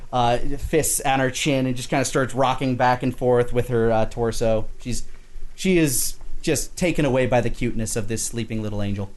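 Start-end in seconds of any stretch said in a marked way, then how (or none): none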